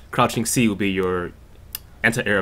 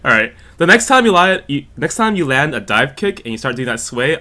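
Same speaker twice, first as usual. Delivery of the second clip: ordinary talk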